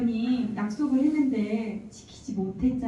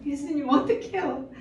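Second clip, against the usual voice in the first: higher, plaintive pleading to Jesus; retold pleading prayer; 6 semitones higher than usual. Wife pleading with Jesus about her hurt heart, plaintive. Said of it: "이렇게 기도를 하는데".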